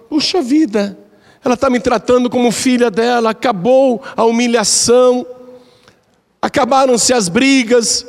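high, pleading regretful voice; remorseful daughter-in-law. Acted. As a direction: let her remorse rise in pitch. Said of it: "a nora ficou arrependida". (nora arrependida, voz aguda e suplicante)

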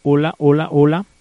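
very loud, level mic check; prueba de micrófono. Real, not acted. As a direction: test the mic loudly, rising at the end. Probando el micrófono. (prueba de sonido en voz muy alta)

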